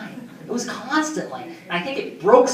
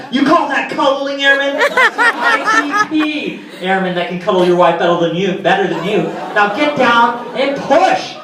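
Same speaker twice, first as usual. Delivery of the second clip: higher and louder sergeant, mocking his cuddling; yelling sergeant with Jersey flavor; 6 semitones higher, 4 dB louder than usual. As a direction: bark a drill order to drop and do push-ups. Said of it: "Sergeant Volante's in my head yelling".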